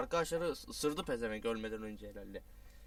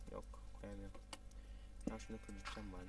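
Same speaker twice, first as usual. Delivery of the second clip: ordinary talk, nothing marked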